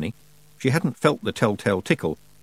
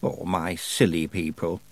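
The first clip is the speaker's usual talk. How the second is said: weary sigh; 5 semitones lower, 5 dB quieter, slower than usual. Acted. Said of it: "Miss Treason sighed".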